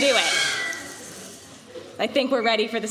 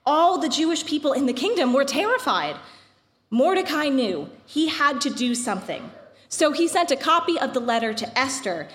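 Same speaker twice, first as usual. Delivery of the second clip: epic fantasy narrator. A narrator's reading. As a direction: tell it like an old legend. Reads the Purim story aloud.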